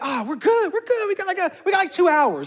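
carefree friend says all is fine; breezy reassurance. Retold or imitated. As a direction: wave the worry away, unbothered. Friend again brushing off worry.

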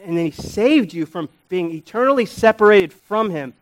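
climbing preacher's fervor; full-force preaching. Acted. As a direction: stir the congregation to awe.

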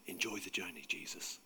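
very soft, low whisper; hushed aside, barely audible. A narrator's reading. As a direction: whisper it privately into the wind. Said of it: "he whispered into the wind".

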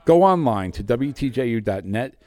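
usual speaking voice

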